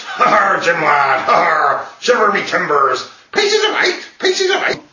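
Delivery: loud, high, hammed-up pirate accent; pirate accent breaking into a parrot-like squawk; generic pirate accent. Shown as a demonstration. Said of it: "it would be a little bit like this".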